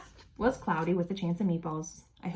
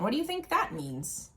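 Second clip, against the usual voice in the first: ordinary delivery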